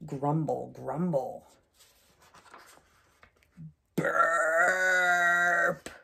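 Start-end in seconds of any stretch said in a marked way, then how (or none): 3.9-5.9 loud mock burp. Crocodile burping the seed out, a loud mock burp. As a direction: belch it out big.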